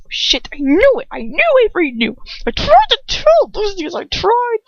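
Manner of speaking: rising panic, almost shrieking; panicked wail. Acted. Narrator panicking at Lucius, high and frantic.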